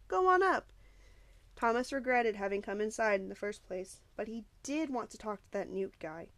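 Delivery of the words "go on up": high, loud sneering dare. A narrator's reading. Bully daring the newcomer to climb, sneering and loud. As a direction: goad him with a loud sneer. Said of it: "said Gally with a sneer".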